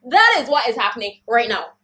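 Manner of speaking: loud, rising anger; getting upset. Real, not acted. Telling viewers the anger is rising again, loud and upset. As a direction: let the frustration break through. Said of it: "I'm getting upset again".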